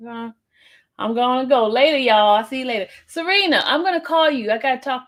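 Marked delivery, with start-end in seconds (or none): 3.1-5.1 high call to Serena; raised calling voice. Real, not acted. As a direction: call her name across the room.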